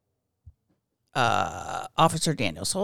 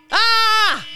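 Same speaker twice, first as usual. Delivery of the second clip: sharp high loud yelp; a sudden shriek of fright; startled shriek; 13 semitones higher, 9 dB louder than usual. Real, not acted. Alarm goes off, host gives a startled high shriek.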